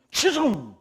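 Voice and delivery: loud high call across the room; ringing shout of the name. Acted. Preacher calls out a name.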